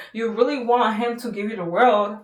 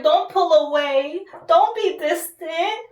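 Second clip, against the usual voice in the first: high, slow, pleading whine; 8 semitones higher, slower than usual. Retold or imitated. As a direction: beg him not to pull away, drawn out. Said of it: "and you're like".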